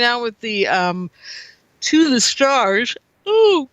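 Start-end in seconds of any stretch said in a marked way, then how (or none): 3.2-3.7 talking through a yawn; words stretched by a yawn. Real, not acted. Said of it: "I'm yawning".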